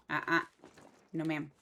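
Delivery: loud firm no to the dog; scolding a dog. Real, not acted. Owner scolding her grumbling dog, loud and firm. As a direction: tell the dog off sharply.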